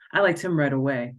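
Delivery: usual speaking voice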